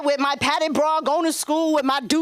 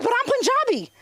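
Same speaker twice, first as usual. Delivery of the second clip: high protesting retort; high indignant young voice; 11 semitones higher than usual. Retold or imitated. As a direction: squeak it out in protest. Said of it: "I'm like".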